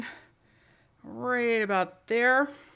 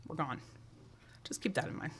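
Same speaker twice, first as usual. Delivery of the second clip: hushed; 6 semitones lower, 16 dB quieter, faster than usual. Real, not acted. Dramatic hush at the point.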